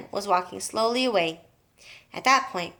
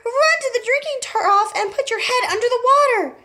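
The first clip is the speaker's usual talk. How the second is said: panicked shriek; 6 dB louder than usual. A narrator's reading. Panicked daughter.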